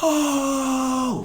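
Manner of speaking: a high, drawn-out oh of shock; dramatic gasp. Retold or imitated. Retelling the crowd's dramatic gasp at the punch, high gasp. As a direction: mimic the crowd gasping in alarm. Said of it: "Everybody is so dramatic".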